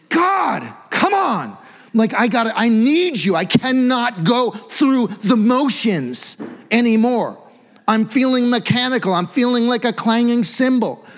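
loud, high, pleading cry to God; desperate hissy fit prayer. Shown as a demonstration. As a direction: cry out to God like you can't take it anymore. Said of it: "this phrase hissy fit is coming to mind".

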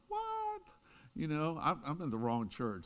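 sharply rising, incredulous "What?"; shocked what. Retold or imitated. Listener in the pew hearing the sermon's claim, pitch leaping up in shock. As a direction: squeak it out like you misheard. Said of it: "And you're going".

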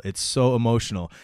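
ordinary talk

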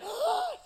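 mock gasp. Shown as a demonstration. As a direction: gasp as if shocked.

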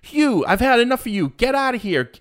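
hammed-up whiny golfer; whiny little baby. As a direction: sulk like a spoiled star sending the fan away. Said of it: "such a whiny little baby".

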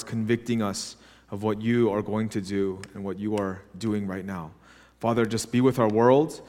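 soft, low, reverent opening prayer. Acted. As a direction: pray quietly to God for the church. Opening prayer before sermon.

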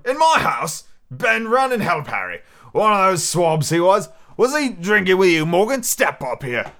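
loud, high, indignant captain; blustering sea cook in a temper; outraged pirate innkeeper. Acted. Plays Silver, outraged.

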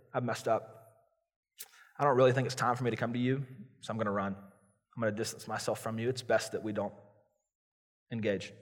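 soft, withdrawing excuse; avoidant retreat. Retold or imitated. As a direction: back away from God, voice fading. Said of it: "We avoid God".

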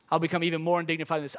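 quick, energetic repeat of the verse; fast preacher echo; fast restatement. Shown as a demonstration. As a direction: rattle it back at speed. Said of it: "repeats the verse".

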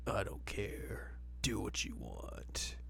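slow, breathy whisper of indifference; mock bored whisper; sulky whisper. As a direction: mutter it like a moody teen. Voicing sulky Koga.

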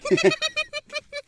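very high-pitched giggle; giggling. Real, not acted. Cohost laughing at being told his laugh is dumb, giggling. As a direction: giggle away while being scolded. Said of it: "Stop giggling".

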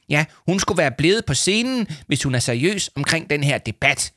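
high, peeved complaint; snide hissing. A narrator's reading. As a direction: sneer it indignantly. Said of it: "vridsede Leo Manchot".

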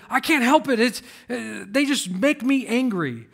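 hammed-up, defensive protest; mock exasperated excuse. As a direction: plead helplessness in an imitated huff. Acting out an excuse for anger.

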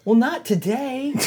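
high, drawn-out whine; mock helpless reply. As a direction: answer as your tripping self, slowly. Sheepish retold answer to girlfriend.

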